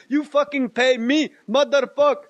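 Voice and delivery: high-pitched imitated demand; mock-angry cabbie. Manhattan cab driver demanding his fare, mock anger.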